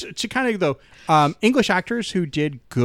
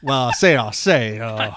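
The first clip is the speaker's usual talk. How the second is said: low Southern rooster bluster; hammed-up cartoon Southern drawl; Foghorn Leghorn; 4 semitones lower than usual. Mocking an actor's accent in Knives Out, Foghorn Leghorn bluster. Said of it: "he's specifically Foghorn Leghorn".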